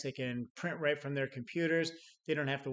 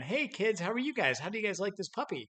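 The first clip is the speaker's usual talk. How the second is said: put-on high, sweet sales pitch to kids; sugary salesperson; 7 semitones higher than usual. Puppy salesperson charming the kids, high and sugary.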